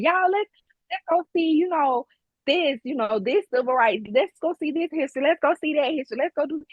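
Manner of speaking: rallying visiting family to go sightseeing; eager invitation. Retold or imitated. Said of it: "Like, I'll be like".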